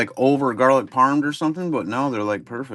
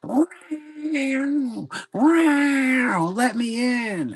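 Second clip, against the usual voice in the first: high, whiny meowing cat; mimicking his cat crying to come in; 13 semitones higher, much slower than usual. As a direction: voice the cat begging at the window, pitched way up. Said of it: "on the screen going".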